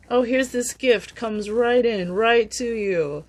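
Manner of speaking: high, loud, showy gift announcement; mock excited. Reader acting out a gift arriving, high and loud hammed-up glee. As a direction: ham up the gift landing in their lap.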